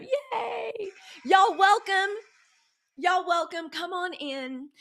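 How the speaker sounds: high-pitched cheer easing into a drawn-out greeting; excited welcome. Real, not acted. Host welcoming the community as they tear up, excited. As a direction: sing out a big high welcome.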